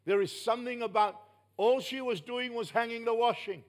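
ordinary delivery

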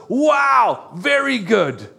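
God thrilled with his best work; God admiring humans, an awed Wow; delighted God. Acted. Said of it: "he goes".

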